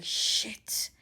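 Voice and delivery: retold curse, soft and voiceless; muttered curse. Date curses on seeing her, muttered. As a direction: swear softly under the breath.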